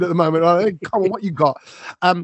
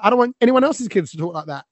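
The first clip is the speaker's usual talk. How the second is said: normal talking voice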